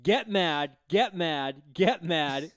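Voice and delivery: ordinary talk, nothing marked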